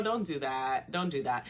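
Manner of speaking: ordinary talk, nothing marked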